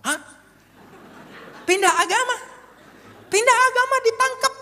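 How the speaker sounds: high, loud cry of disbelief; astonished outcry. Retold or imitated. Cellmate learns he was jailed for changing religion, loudly astonished. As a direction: blurt it out, stunned. Cellmates shocked at his crime.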